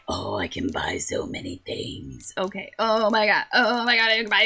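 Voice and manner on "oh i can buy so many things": much lower, slow and softer; deep character voice. Acted. Shopkeeper character marvelling at goods, low and slow.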